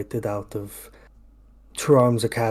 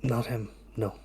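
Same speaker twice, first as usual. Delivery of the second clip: quiet; 7 dB quieter than usual. Acted. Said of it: "looks up at you and goes".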